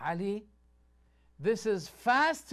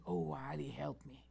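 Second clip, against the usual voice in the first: retold shout; 4 semitones lower, 10 dB quieter than usual. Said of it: "she was shouting".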